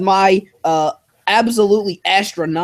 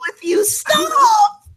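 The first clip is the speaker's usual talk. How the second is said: screaming in disgust; much slower than usual. Real, not acted. Disgusted at lip syncing avatar.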